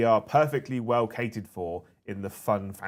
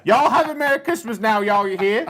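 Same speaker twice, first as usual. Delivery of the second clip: high, loud hammed-up Texan drawl; high-pitched Texan; 14 semitones higher, 6 dB louder, slower than usual. Reading a viewer's message aloud in high-pitched Texan accent. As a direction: play a squeaky cowboy reading chat. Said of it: "a high-pitched Texan".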